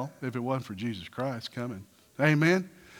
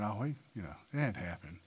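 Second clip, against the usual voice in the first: mock scoffing; 9 semitones lower, 16 dB quieter, faster than usual. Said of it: "People are, like, scoffing, saying".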